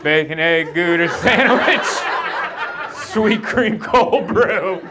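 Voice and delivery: high, stretched-out, loud recital of the order; helpless rote blurting. Retold or imitated. Thrown customer blurting his memorized order at cashier, high and drawn-out.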